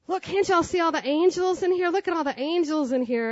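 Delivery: loud, urgent appeal to the rival gangs; calling out. Retold or imitated. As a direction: raise your voice over the two groups. Calling out to the gang fight.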